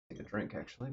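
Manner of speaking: ordinary talk, nothing marked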